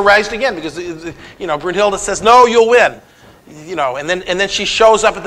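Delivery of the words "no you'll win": louder, higher retold exclamation; emphatic mock promise. Brunhilde raises Sigmund's hopes, retold in a raised, emphatic voice. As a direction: proclaim it firmly, as a quoted assurance.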